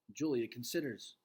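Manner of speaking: usual speaking voice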